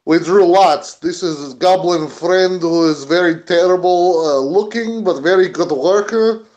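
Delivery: put-on broken English with a Russian accent. Worker explains the group to the foreman's guard, Russian accent. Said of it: "Using my Russian accent, I've learned".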